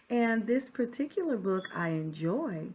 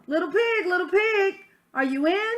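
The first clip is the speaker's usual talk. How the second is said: higher and louder, calling through the door; 11 semitones higher, 9 dB louder than usual. Acted. Wolf calling to the pig at his door, calling out. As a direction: call into the straw house.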